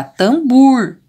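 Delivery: TAMBOR as "tamBUUR", long loud oo at the end. Shown as a demonstration. Teacher testing the wrong vowel, drawn-out "tambur". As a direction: stretch a U in place of the O of TAMBOR. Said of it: "se eu colocar o U aqui".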